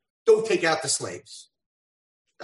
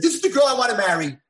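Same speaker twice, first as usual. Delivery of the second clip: loud, fast declaration; proud declaration; 8 semitones higher, 8 dB louder, faster than usual. Acted. Hashem telling the angels his choice, loud and fast. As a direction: announce it with joy.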